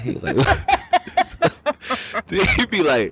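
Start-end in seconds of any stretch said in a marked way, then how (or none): 0.4-2.2 long, high burst of laughter; high-pitched laugh. Real, not acted. Comic cracking up at own story, high laugh.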